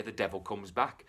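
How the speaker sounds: plain reading voice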